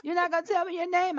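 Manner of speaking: high, loud incredulous question; imitated indignant suitor. Driver pressing the girl for her name, imitated indignation. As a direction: mimic a man put out at being refused. Rebuffed suitor in retold exchange.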